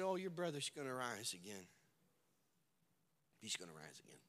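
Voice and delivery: low, soft and calm; Jesus softly comforting Martha; gentle reassurance. Acted. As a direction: soothe her quietly.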